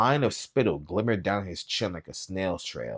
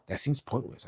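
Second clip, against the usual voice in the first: dull; 6 semitones higher, 6 dB quieter than usual. Acted. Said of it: "I said dully".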